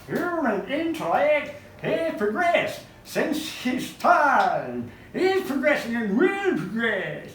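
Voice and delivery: high, slow cartoon Yankee; ridiculous Yankee accent. Heckler answering Dickens, ridiculous drawn-out Yankee accent. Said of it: "in a ridiculous Yankee accent".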